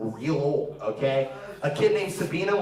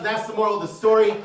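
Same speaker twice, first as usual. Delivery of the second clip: ordinary talk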